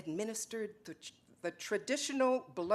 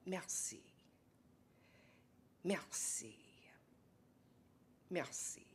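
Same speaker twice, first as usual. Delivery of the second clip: whispered thanks; 3 semitones lower, 14 dB quieter than usual. Acted. Cobbler bows to count.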